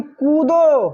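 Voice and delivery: teacher shouting an order; sharp command. Shown as a demonstration. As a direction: fire it off as an order. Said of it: "वो order दे रहा है".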